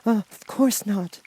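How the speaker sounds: put-on high, slow child's voice; a startled girl answering; bewildered little girl. A narrator's reading. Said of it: "answered Dorothy".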